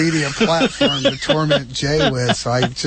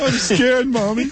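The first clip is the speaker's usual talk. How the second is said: put-on high, playful frightened-kid voice; mock scared child; 9 semitones higher than usual. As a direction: whimper for Mommy in jest.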